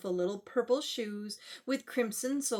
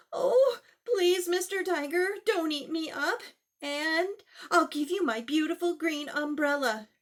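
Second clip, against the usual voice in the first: put-on higher, pleading child voice; 4 semitones higher than usual. Babaji begging the tiger, high and pleading. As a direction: beg the tiger not to eat you.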